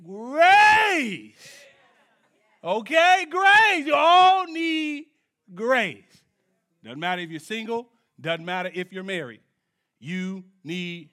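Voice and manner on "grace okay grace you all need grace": high, loud, drawn-out pulpit cry; preacher's shout. Real, not acted. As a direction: belt it out to the congregation. Preacher shouts the key word.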